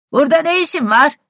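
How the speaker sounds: loud, high call across the yard; shouted from a window. A narrator's reading. Sour servant shouts down from the stable window. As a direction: yell down at the stranger at the door. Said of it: "diye bağırdı".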